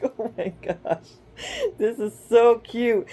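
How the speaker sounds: high, giggly gush of delight. Retold or imitated. Mother reacting to her son saying he loves her, giggly gushing. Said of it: "it makes me giggle".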